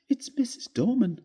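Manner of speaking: put-on high, tearful sob of a young wife; sobbing. Wife sobbing to her husband about the servant. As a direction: blurt it out through tears, high. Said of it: "she sobbed".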